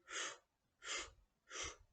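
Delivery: soft voiceless f puffs, like blowing out a candle; blown f puffs. Shown as a demonstration. Teacher shows kids how to blow out a candle: soft breathy f puffs. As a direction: puff out short, breathy f sounds. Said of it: "видихай через ротик із звуком ф".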